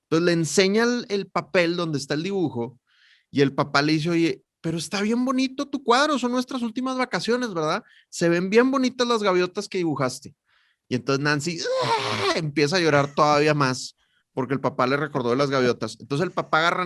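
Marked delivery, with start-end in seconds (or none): 4.1-10.3 higher, coaxing father's voice; sweet doting dad. Acted. Dad comforting his crying daughter about her drawing, higher and sweet. 11.8-12.3 sudden high-pitched wail; child's wail. Acted. The girl Nancy bursting into tears again, a high wail.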